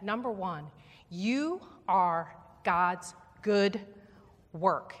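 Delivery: you are God's good work, each word spaced and weighted; slow, weighted emphasis on "you are God's good work"; emphatic slow declaration. Shown as a demonstration. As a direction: drive it home slowly. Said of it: "I want to emphasize the fact that".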